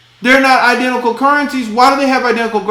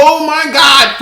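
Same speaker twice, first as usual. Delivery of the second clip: ordinary talk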